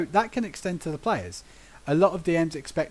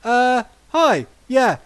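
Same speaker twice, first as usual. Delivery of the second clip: mock sheepish backtracking; 7 semitones higher, 4 dB louder than usual. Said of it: "he's losing it at someone that he knows can wreck him".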